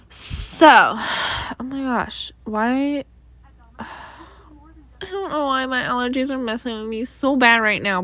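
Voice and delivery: normal talking voice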